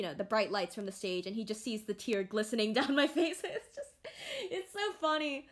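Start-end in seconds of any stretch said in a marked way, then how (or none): 2.7-5.5 breaking up with amusement; laughing. Real, not acted. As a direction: voice climbing high into laughter. Laughing at being caught crying.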